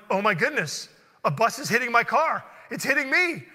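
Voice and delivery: loud, climbing exclamations; retold alarmed disbelief. Retold shock of being hit.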